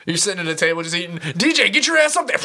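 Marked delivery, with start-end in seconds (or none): 1.4-2.4 loud, higher mock order at the DJ; mock shout. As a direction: bark it like a club boss. Mock boss yelling at DJ.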